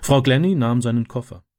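ordinary delivery, nothing marked

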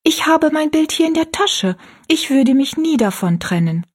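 put-on high-pitched, earnest little girl; girl's voice. A narrator's reading. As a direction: speak up as a young girl. Narrator voices young Rosalie.

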